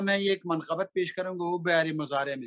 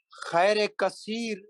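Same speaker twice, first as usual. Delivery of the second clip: normal talking voice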